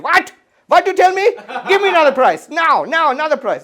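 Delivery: loud, high, outraged haggling; indignant haggling aunt. Retold or imitated. Aunt haggles with a shopkeeper, loud outraged demand. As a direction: slam the price back like a bazaar bargain. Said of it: "this is the Indian hand".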